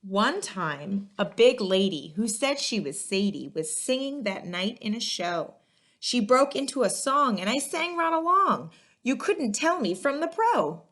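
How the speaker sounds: put-on lively read-aloud to children, rhymes bouncing; story narration. A narrator's reading. Librarian reading a picture book to kids, lively and rhythmic. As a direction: storytime voice for young listeners.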